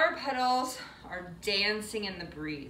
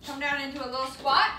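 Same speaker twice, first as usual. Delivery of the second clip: ordinary talk, nothing marked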